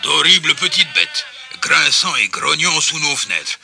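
loud, grumbling householder; angry husband. A narrator's reading. Monsieur Lapierre threatening the noisy animals, angry. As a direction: threaten them loudly.

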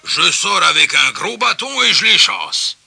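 loud, grumbling householder; angry husband. A narrator's reading. Monsieur Lapierre threatening the noisy animals, angry. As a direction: threaten them loudly.

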